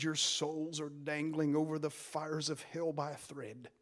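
low, hushed warning; a hint of Edwards' dread. Preacher quoting Edwards' sermon to the church, hushed and low. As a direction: hush it like a grim secret. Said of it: "And he said".

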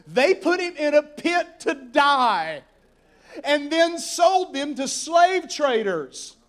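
loud, high, slow preaching shout. Real, not acted. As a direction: hammer each word out to the crowd. Preacher builds to climax.

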